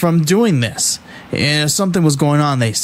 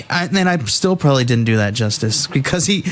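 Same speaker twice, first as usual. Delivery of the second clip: ordinary talk, nothing marked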